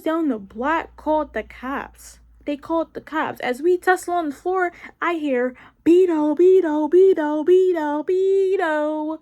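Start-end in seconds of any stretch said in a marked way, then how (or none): 5.8-9.2 loud repeated call across the room; calling out a name. Retold or imitated. Someone outside the fight calling out for Beto. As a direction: shout the name again and again to find him.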